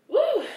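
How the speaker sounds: high loud whoop; excited woo. Real, not acted. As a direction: let out a hype whoop. Hyping herself up to walk.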